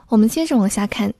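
usual speaking voice

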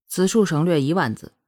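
usual speaking voice

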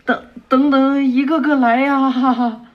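stammering, shy and pleased; bashful. Acted. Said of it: "木叶丸就害羞的说".

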